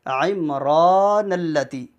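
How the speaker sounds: "عمران" with clear ain and long held raa; slow "عمران اللتی", drawing out the aa; stretched recitation. Shown as a demonstration. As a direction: recite it unhurried, long middle vowel. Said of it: "کھینچ کر".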